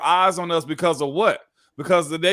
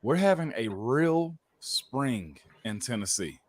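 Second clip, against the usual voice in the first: slow, low and softer; real slow; 5 semitones lower, 8 dB quieter than usual. Shown as a demonstration. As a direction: spell it out word by word, slowly. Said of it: "I'm gonna say this real slow".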